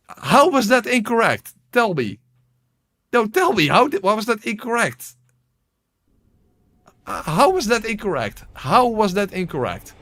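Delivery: loud, high, demanding rant; indignant. Real, not acted. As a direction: demand an answer, outraged. Demands answer from game.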